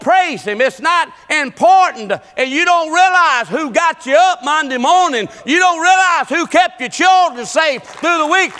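high, driving preacher's cry; full-force preaching. Real, not acted. Preacher reminding church who woke them Monday, high and forceful. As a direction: preach it hard, voice pitched high.

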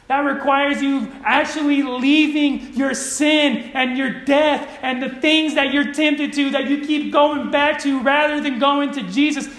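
high, slow, emphatic exhortation; forceful preaching. Real, not acted. Preacher urging listeners to leave their sin behind, forceful preaching. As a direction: press each demand on the congregation.

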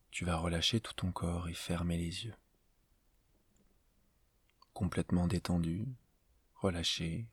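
slow, spaced-out meditation voice with long pauses; guided meditation. Acted. Teacher leading a listener into yoga nidra before sleep, calm guided meditation. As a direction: guide the listener gently through a body scan, unhurried.

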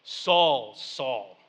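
lightly voiced booming voice from above, falling low; a restrained boom, calling a name from the sky. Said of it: "a booming voice says".

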